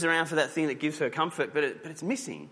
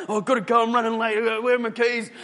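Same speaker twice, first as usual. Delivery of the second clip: higher, louder, frantic; flustered hurry; 10 semitones higher, 6 dB louder than usual. Retold or imitated. Person late for work, can't find keys, frantically flustered. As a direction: play someone late and rushing.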